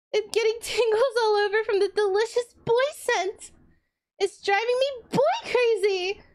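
high, giddy acted girl voice; hammed-up excited reading of a silly script. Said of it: "I'm gonna try my best to voice act it".

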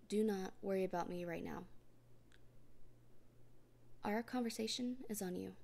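normal talking voice

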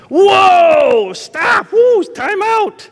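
high, loud alarmed shout, slowed on the last words; panicked yelp. Retold or imitated. Patient in the chair yelling at the dentist to stop.

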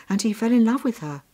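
usual speaking voice

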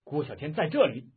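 loud, bold shout; defiant shout. A narrator's reading. Archer at the window shouts at the officer, defiant shout. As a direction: announce yourself with a bow drawn. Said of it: "喝道".

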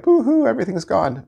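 high mock wailing; high mocking lament; mock boo-hoo. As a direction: fake sob, hammed-up. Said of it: "they're like".

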